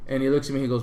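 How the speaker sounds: normal talking voice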